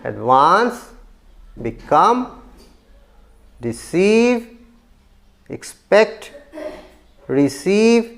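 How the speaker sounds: advance, become, receive, expect, receive: short first syllable, long lifted second; stress on second syllable. Shown as a demonstration. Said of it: "syllable number टू है". (advance, become, receive, expect, receive के दूसरे syllable पर ज़ोर)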